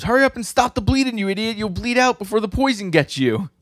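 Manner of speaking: very high, loud mock-frantic scolding; hammed-up panicked telling-off; urgent scolding. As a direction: yell it urgently in a high-pitched character voice. Acted urgent scolding of bleeding fighter.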